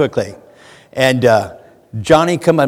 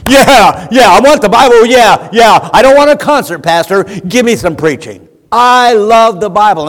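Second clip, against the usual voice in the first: louder, excited churchgoer cheering for preaching; eager fan; 5 semitones higher, 6 dB louder than usual. Pretend. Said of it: "to be a fan of it".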